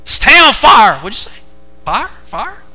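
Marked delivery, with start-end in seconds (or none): 0.0-1.0 loud, high Arkansas twang; Arkansas accent. Shown as a demonstration. Preacher repeating how they say it down there, Arkansas accent. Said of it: "how they all say it down there". 1.9-2.6 high questioning Arkansas "far?"; puzzled echo of an Arkansas "far"; Arkansas accent. Shown as a demonstration. Preacher echoing the Arkansas saying as a question, Arkansas accent. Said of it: "how they all say it down there".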